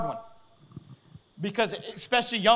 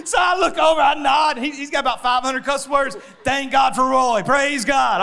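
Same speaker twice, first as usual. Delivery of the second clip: loud, higher, joyful shouting; excited shout; 6 semitones higher, 9 dB louder than usual. Real, not acted. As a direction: shout the punchline in delight. Laughing punchline, praise.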